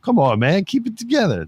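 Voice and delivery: one hardened man urging another to hold it in; mock comforting a crying friend; tough guy consoling. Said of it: "It's like".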